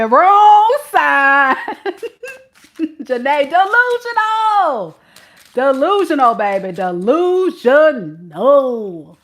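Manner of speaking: high, drawn-out teasing with laughs; mocking sing-song. Reader taunting the ex, high and laughing. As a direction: stretch it out like a playground taunt.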